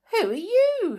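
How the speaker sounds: high, startled question; surprised girl. Acted. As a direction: gasp it out in surprise. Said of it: "stared at them in surprise".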